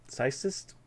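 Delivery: "Psychist" softly fumbled with a lisp; lisped slip. Real, not acted. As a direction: stumble quietly over "Psychist". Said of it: "Sorry, my lisp".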